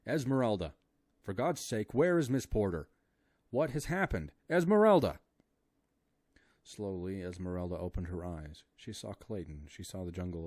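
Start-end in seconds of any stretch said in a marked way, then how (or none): 0.1-5.2 high, loud frantic yelling, climbing to the last name; panicked shrieks building to a peak; frantic shouting. A narrator's reading. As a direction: shout at her louder and louder. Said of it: "he shrieked in her ear".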